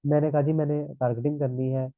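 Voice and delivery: usual speaking voice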